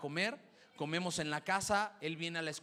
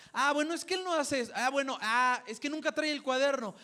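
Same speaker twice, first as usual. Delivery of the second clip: higher, louder, fussy aunt making excuses; excusing aunt; 7 semitones higher, 5 dB louder than usual. Retold or imitated. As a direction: gush out excuses like a doting aunt. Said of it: "había una persona que lo quería disculpar".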